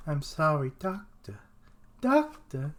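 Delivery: "doctor" jumps up high; high-pitched voice. Acted. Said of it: "that damn high-pitched fucking voice".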